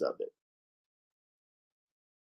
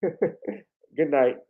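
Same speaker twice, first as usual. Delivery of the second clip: laughing; 4 semitones higher than usual. Real, not acted. Laughing after impression.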